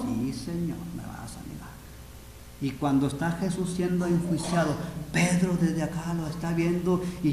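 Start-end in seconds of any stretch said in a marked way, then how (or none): 0.0-1.7 low, soft answer; hushed reply after an outburst; quiet certainty. Acted. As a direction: drop right down and state it gently. Jesus quietly answers Peter's protest.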